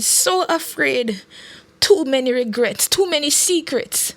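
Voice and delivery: a touch of sobbing, pleading out her regrets; tearful confession. A narrator's reading. Minister confessing her fear and secrets to Lorian, mildly sobbing. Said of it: "The minister was sobbing now".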